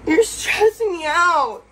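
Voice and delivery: high, loud, drawn-out wail of frustration; loud, slow, distressed complaint; upset outburst. Real, not acted. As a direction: cry it out, overwhelmed and at your limit. Real argument, overwhelmed with companion.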